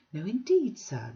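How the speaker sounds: put-on high, genteel old lady; prim Mrs Sparsit. Mrs Sparsit primly questioning Bounderby, high and genteel. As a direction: lift it into a prissy, mannered voice.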